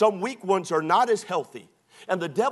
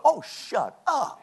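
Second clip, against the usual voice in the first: higher, drawn-out mock exasperation; exasperated dismissal; 6 semitones higher, slower than usual. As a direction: cut off the gossip with a long, high put-down. Rebukes the gossip just voiced.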